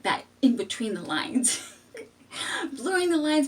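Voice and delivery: talking through giggles; laughing while explaining the loophole. Real, not acted. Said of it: "I'm already laughing".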